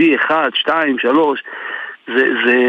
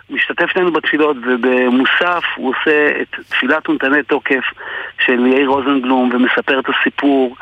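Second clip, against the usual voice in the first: normal talking voice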